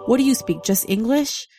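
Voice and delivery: ordinary talk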